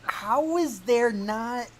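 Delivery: loud, high-pitched incredulous questioning; amused disbelief. Real, not acted. Reacts in disbelief to story.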